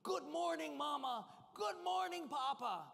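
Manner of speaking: put-on excited child. Little son waking his parents, high excited squeal. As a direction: bounce with a three-year-old's glee. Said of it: "he was screaming".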